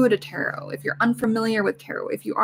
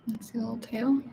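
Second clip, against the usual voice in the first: whisper; 8 dB quieter, faster than usual. Real, not acted. Coaxing the cat.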